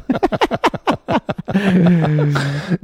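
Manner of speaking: high, loud burst of laughter; big laugh. Real, not acted. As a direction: laugh hard at the punchline. Laughs at own punchline.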